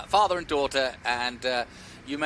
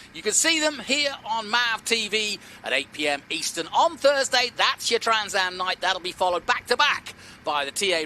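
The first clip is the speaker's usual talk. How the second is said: excited announcer; 7 semitones higher than usual. Acted. Booth commentator promoting highlights.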